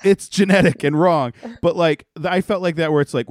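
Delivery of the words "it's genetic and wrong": higher, louder mock exclamation; hammed-up indignant cry, louder; mock loud outburst. Mock quote about calves.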